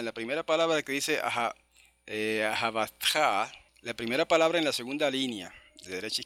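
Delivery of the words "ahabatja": ahabatja drawn out onto a stressed final "tja"; stress on last syllable. Shown as a demonstration. Teacher pointing out a Hebrew word, final stress. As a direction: land slowly on the end of ahabatja. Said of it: "el acento va al final".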